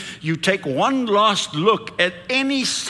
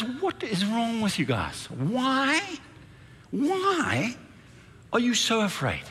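mildly exasperated, high and soft; incredulous questioning, pausing on each "why"; Jesus; 5 semitones higher, 6 dB quieter, slower than usual. Acted. Jesus asking the woken disciples, a little ticked off. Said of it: "Jesus is a little bit ticked off".

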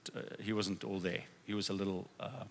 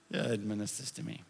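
ordinary talk, nothing marked

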